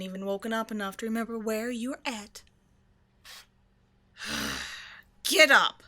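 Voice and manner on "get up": loud, sharp wake-up shout; barked order. Acted. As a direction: shout them out of bed, pitch up then plunging. Vampire orders groaning captive up.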